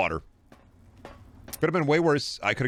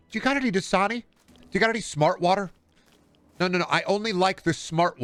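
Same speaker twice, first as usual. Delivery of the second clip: much higher put-on woman's voice; woman in the desert; 6 semitones higher than usual. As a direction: pitch it way up for a fussy lady asking for water. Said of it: "Okay, woman crawling through the desert".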